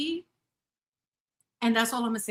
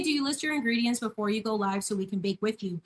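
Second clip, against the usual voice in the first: ordinary delivery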